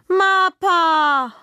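lauter, hoher Kinderschrei nach Mapa; schreit. Acted. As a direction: ruft laut und hoch nach der Mama. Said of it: "schreit sie". (Kind schreit laut nach ihrer Mapa)

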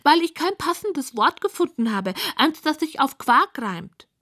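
high, put-upon pig voice; high piggy lament; complaining pig. A narrator's reading. Pig explains her sleepless night.

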